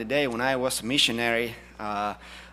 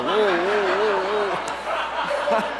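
high, loud startled whoa; 7 semitones higher, 5 dB louder, much slower than usual. Retold or imitated. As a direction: jump back in mock alarm. Startled by wife's voice.

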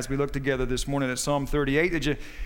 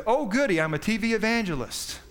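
much higher, mock-gleeful punchline voice; gleeful snake; 6 semitones higher than usual. Rattlesnake learns what he is, high mock glee. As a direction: deliver the twist like a giddy TV preacher.

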